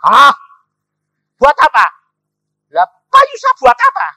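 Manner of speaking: loud, high incredulous outburst; acted astonishment, very loud and high; stunned disbelief. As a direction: shout the questions in shock. Stunned by the huge amount.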